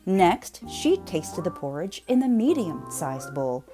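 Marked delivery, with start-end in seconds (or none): none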